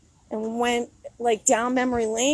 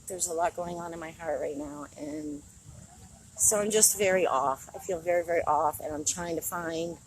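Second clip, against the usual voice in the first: quiet; 5 semitones lower, 6 dB quieter than usual. Real, not acted. Quiet confession of feeling off.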